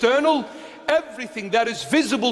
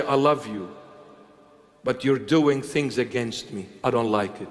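lightly voiced Christ, tender; 10 semitones lower, 3 dB quieter than usual. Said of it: "and he will say".

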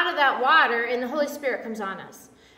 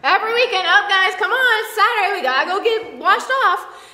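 higher, louder rallying nag with a laugh; Roxanne; 5 semitones higher, 5 dB louder than usual. Retold or imitated. Woman herding others to weekly washing, playful loud urging. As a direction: playfully round everybody up, raised voice. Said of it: "I know Roxanne's like".

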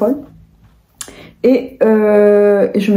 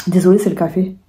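usual speaking voice

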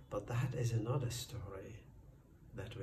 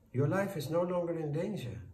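mildly angry, higher-pitched farmer; a touch of anger, pitch raised; firm, irritated insistence; 5 semitones higher, 4 dB louder than usual. A narrator's reading. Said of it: "said the farmer angrily".

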